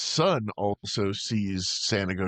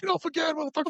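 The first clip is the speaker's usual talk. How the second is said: mock taunt; faster than usual.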